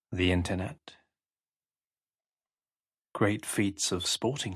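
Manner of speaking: slow hypnotic voice with long pauses between short phrases; hypnosis induction. Acted. Hypnotist guiding a listener to close their eyes, slow hypnotic delivery.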